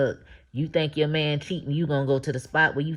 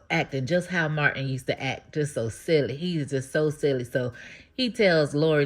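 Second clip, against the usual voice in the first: ordinary talk, nothing marked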